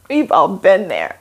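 laughing while speaking; amused, relatable laugh. Real, not acted. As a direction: laugh through the words. Laughs at own joke.

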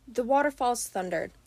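ordinary delivery

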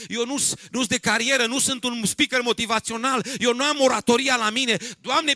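loud, high protesting plea; preacher crying out an acted plea; full-force preaching. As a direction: act out a desperate refusal at sermon volume. Preacher acts Moses refusing God's call.

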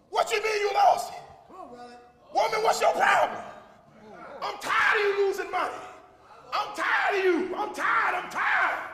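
angry husband. Retold or imitated. A husband yelling at his wife over lost money, loud. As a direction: blow up at the wife.

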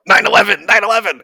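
loud, high, eager shout of the answer; shouting the answer at the trainer; blurted shout. Acted. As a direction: yell it out like a quiz answer. Acted trainees blurting stereotypes.

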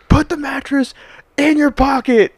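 very high, strained mock command; insistent drill instructor. Instructor to a baffled recruit, mock yelled insistence. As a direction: imitate an instructor hammering the order home. Instructor repeating impossible order.

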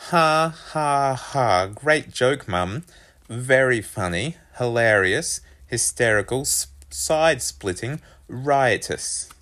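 mock laugh, then emphatic sarcastic praise. A narrator's reading. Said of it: "I thought it was anything but".

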